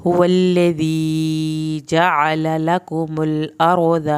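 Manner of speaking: slow chanted tajweed recitation; Quran recitation. Shown as a demonstration. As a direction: intone the verses slowly. Teacher recites verses.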